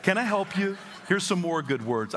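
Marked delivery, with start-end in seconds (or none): 0.0-0.8 high, bright and eager; sunny high offer to help; cheerful eager offer. Shown as a demonstration.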